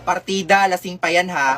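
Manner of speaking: ordinary talk, nothing marked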